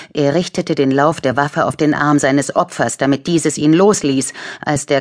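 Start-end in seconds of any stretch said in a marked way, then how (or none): none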